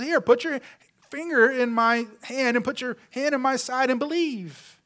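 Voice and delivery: high, urgent Jesus inviting touch; eager invitation. Acted. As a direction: offer the proof with lifted voice. Jesus offering Thomas proof.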